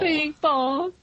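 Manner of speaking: high mock sob on the name; hammed-up tearful Bing Bong; mock cry. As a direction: wail it in fake grief.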